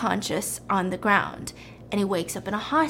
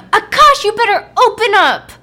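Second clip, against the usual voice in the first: high, loud demand through a door; angry shouting; 10 semitones higher, 11 dB louder than usual. Retold or imitated. Girlfriend slamming on the door, angry high shout. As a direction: yell it as if pounding to get in. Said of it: "she starts slamming on the door".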